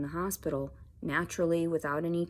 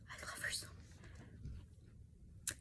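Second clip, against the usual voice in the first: much softer, slow whispered words of love; tearful whisper; 17 dB quieter, much slower than usual. Real, not acted. Telling viewers about her rescued cat in a tearful whisper. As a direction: murmur it through tears.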